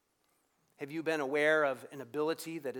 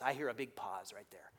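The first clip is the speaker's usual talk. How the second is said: whispered aside; 7 semitones lower, 6 dB quieter than usual. Real, not acted. Aside about the pause.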